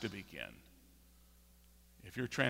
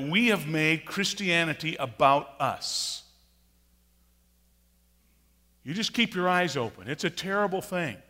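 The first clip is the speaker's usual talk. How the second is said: rising, louder fiery preaching; preaching at full force; 5 semitones higher, 7 dB louder than usual. Acted. Preacher rebuking the congregation, fiery and louder. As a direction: thunder it from the pulpit. Preaches American Christianity critique.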